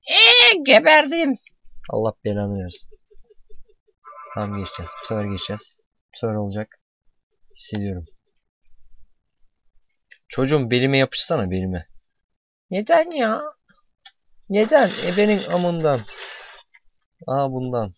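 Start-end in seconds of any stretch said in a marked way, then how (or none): 0.1-1.3 very high, loud, drawn-out squeal; comic death screech. Pretend. Player whose character just died, high comic screech. 12.7-16.0 high-pitched squealed complaint; whiny high protest. Pretend. Player failing in the game, high squealed protest.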